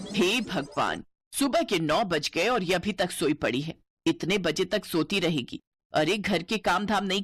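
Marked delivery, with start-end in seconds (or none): none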